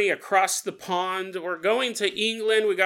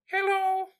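tiny, high creature voice; little voice; 11 semitones higher, 3 dB quieter than usual. Acted. Mushroom heard through headphones, tiny high voice. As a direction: squeak it out small and drawn. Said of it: "we hear this little voice go".

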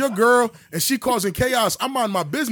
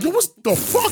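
high, incredulous yo; dazed outrage; 11 semitones higher than usual. Retold or imitated. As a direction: snap back up shocked. Stunned after getting hit.

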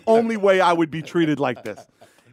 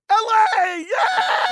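excited cheer; much slower than usual. Retold or imitated. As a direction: cheer it out like a fan. Celebrating arrival in LA.

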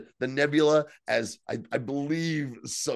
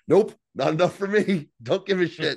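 defiant refusal; 6 semitones higher, 5 dB louder than usual. Retold or imitated. Retells captain's defiant refusal.

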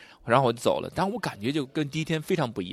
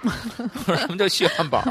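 laughing through words; 9 semitones higher, slower than usual. Retold or imitated. Retelling his own absurd request.

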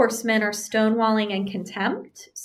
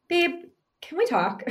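higher, softer gentle opener; gentle startup; 7 semitones higher, 4 dB quieter than usual. Shown as a demonstration. Wife opening the talk with her husband, soft gentle ask. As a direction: ease into it sweetly, lightly voiced. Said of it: "where you start the conversation gently".

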